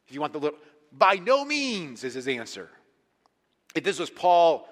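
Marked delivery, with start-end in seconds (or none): 0.9-2.0 raised, loud, drawn-out refusal; acted emphatic denial. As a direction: stretch it out slowly and forcefully. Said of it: "this is so emphatic".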